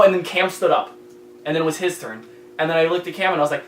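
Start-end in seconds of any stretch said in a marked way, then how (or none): none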